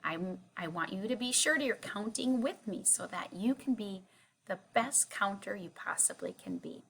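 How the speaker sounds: ordinary talk, nothing marked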